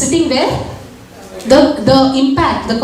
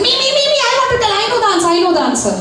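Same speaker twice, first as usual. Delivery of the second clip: much higher, fast and eager; eager child; 10 semitones higher, much faster than usual. Retold or imitated. Neil begs the teacher to pick him, high excited child voice. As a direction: imitate a kid bursting to answer. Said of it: "And Neil is like".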